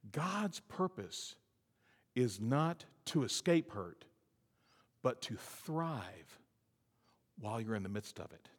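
slow and soft, each word spaced; slow, soft emphasis. Real, not acted. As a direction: let it sink in quietly. Preacher drops to slow hush.

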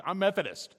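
loud, high, huffy imitation of a householder; mock indignant shout. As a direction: bark it back like an offended neighbor. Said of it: "that's how they said it too".

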